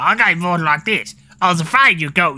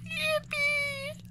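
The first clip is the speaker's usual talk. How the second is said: much higher, tiny squeaked yippee; extra squeaky cheer; 14 dB quieter than usual. Pretend. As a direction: squeak it like a cartoon.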